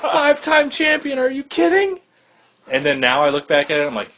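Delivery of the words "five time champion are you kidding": much higher, laughing excited squeal; giddy disbelief. Retold or imitated. Young fan cheering Hogan's fifth title, laughing and squealing. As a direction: relive a child's delighted shock. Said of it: "I was like".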